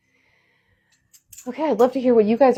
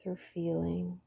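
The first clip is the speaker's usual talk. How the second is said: soft spa whisper; 11 dB quieter than usual. Acted. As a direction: lead them gently into calm. Leads a guided meditation.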